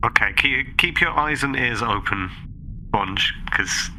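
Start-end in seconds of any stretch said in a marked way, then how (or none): none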